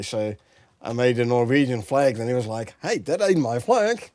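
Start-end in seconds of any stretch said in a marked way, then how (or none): 2.8-4.1 high, louder imitation of an objecting friend; mock protest. Friend objects to the flag made for him, mock protest. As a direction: raise the pitch for a playful retold objection.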